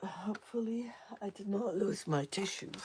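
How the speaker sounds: hushed aside to herself; whispering. Real, not acted. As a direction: whisper it under your breath. Whispered aside about missing tissues.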